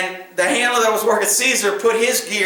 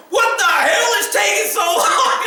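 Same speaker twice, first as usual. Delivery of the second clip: high mock shout of an impatient general; impatient yell; 10 semitones higher than usual. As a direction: yell it, fed up and in a hurry.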